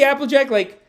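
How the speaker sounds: ordinary talk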